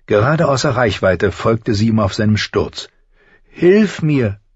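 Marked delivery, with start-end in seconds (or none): 3.5-4.4 high, pleading cry. A narrator's reading. Falling boy begs the crow for help, pleading. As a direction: beg for help in a raised voice. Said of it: "bat er".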